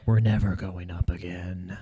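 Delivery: slow mock whisper of a gloomy holder; doom whisper. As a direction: whisper it slowly like all hope is gone. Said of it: "they're like".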